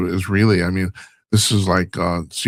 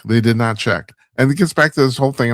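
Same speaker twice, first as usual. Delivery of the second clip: ordinary talk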